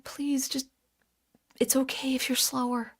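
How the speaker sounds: whispered, pleading softly; hushed plea. Retold or imitated. Imagined whispered plea to the young pianist. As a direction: beg her gently in a hushed voice. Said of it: "I'm like".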